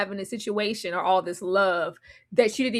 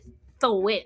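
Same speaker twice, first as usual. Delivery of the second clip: normal talking voice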